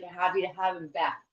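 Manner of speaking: ordinary delivery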